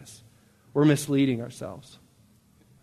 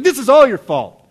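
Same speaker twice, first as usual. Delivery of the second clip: loud, high-pitched angry blame; raised-voice retold outburst; Moses; 8 semitones higher, 10 dB louder than usual. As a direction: lash out at the people, imitating. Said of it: "he lashes out and he blames them".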